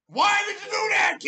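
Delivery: angry fan shouting why did you do that; furious shout. Real, not acted. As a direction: yell it high and loud in disbelief. Angry fan yelling at team.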